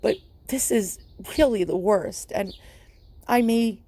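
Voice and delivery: tear-choked, strained pitch; pleading through real tears; grieving owner missing her cat, crying; tearful crying. Real, not acted. Grieving owner after cat died.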